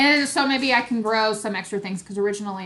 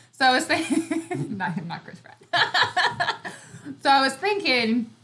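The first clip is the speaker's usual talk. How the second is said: laughing; 7 semitones higher, 3 dB louder than usual. Real, not acted. Giggling over Chris Pratt joke.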